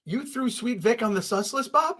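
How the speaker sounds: teasing, incredulous accusation; mock indignation. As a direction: feign offense at a friend's betrayal. Mock-scolds co-host for doubting Vic.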